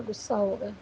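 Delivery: ordinary talk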